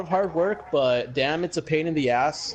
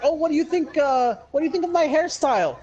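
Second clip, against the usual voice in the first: high, sing-song mock of a girl asking; lightly hammed-up schoolgirl asking about her hair; girly mock voice; 10 semitones higher than usual. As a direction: pitch it way up like a teen girl fishing for praise.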